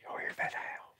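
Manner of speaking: breathy hushed whisper. Real, not acted. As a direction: whisper so you don't scare it. Hearing a howl in the dark.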